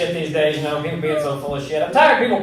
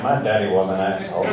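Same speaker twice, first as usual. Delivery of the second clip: much lower, gruff mock voice; Klan leader; 11 semitones lower than usual. Klan Grand Wizard admitting his father's racism, deep. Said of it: "Grand Wizard ... is like".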